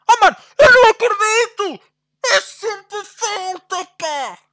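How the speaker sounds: high, loud wail of disbelief at the foul call; anguished outcry. Real, not acted. Streamer wailing at the referee's foul call, anguished outcry. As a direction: cry out like the game just robbed you. Gamer raging at referee calls.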